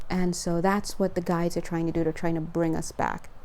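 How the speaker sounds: usual speaking voice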